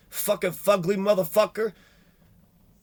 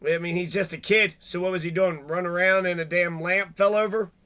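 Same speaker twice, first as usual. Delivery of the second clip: ordinary delivery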